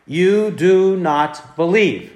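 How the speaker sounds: slow, each word weighed; slow emphatic quote. Shown as a demonstration. Preacher slowly quoting Jesus' rebuke to the unbelieving crowd, solemn.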